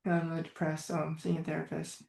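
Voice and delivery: imitating a gloomy confession, barely voiced out; mock depressed. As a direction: let it drop to a hushed, downcast murmur. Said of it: "you can tell something is going on behind that".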